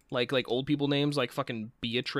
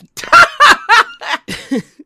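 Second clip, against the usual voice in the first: loud high laugh; 17 dB louder than usual. Real, not acted. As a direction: burst of high-pitched laughter. Laughing at Norman line.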